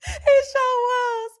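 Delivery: high, slow, amused tease. Real, not acted. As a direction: tease while still giggling. Still laughing, teasing.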